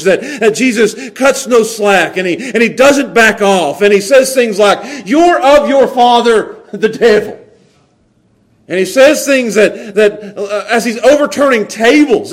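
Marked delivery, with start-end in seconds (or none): 5.1-7.4 strong, critical voice. Retold or imitated. Jesus rebuking with no slack, strong and critical. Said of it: "This voice is strong and it's, it's critical".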